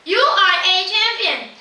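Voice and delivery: loud, high echo reply. A narrator's reading. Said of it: "The voice replies".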